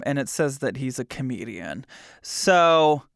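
ordinary talk, nothing marked

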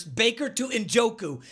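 usual speaking voice

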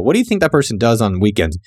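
ordinary talk, nothing marked

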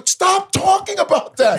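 very high shouted order; mock shout. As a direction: yell it shrill, frantic. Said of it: "He's back to back going".

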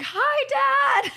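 very high, drawn-out gleeful hello; imitated high-pitched excited greeting; excited mock greeting. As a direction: squeal it out like a delighted daughter. Acting daughter greeting spirit father.

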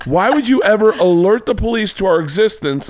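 high-pitched alarmed scolding; urgent, incredulous reproach; shaken friend. Retold or imitated. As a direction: imitate a rattled friend's disbelief. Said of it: "Jesus is shaking. He's like".

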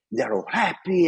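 ordinary talk, nothing marked

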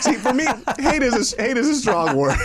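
high, talking through laughter; joking while cracking up; laughing. Real, not acted. Co-host teasing, laughing through the words.

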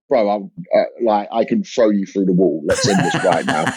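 low, blunt, half-joking menace; mock threat. Comedian threatening a heckler, low mock menace. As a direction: warn the heckler off, deadpan low.

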